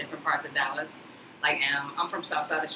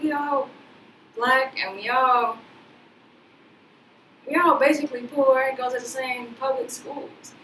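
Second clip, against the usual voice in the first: a bit higher, lightly acted disbelief; retold own protest; 8 semitones higher, 3 dB louder than usual. Speaker retelling arguing with her friend, a bit higher. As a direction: replay her younger self arguing, half-incredulous. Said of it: "I was like".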